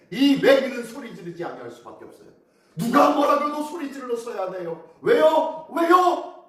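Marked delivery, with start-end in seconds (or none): 5.0-6.4 loud, high-pitched repeated question; impassioned preaching. Real, not acted. Preacher presses the congregation for the reason, impassioned preaching. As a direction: fire the question out, higher the second time.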